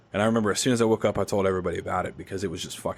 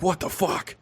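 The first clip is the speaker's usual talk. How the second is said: high-pitched mock shock on waking; 10 semitones higher than usual. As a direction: yelp it out in sudden disbelief. Waking from dream death, shocked.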